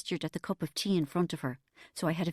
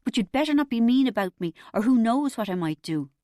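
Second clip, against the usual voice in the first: put-on petulant teenager; 4 semitones higher, 6 dB louder than usual. A narrator's reading. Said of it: "I sounded like a thirteen-year-old".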